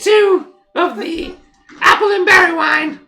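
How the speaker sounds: high, loud squeaky sales cry; squeaky halfling. Acted. Halfling sells a traveller wine, squeaky shout. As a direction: squeal it loudly and eagerly. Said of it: "this kinda squeaky voice like".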